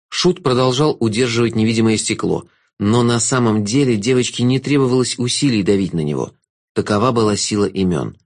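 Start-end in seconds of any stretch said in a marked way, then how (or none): none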